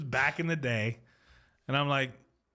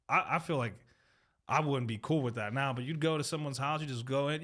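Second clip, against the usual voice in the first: usual speaking voice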